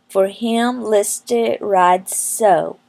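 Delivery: usual speaking voice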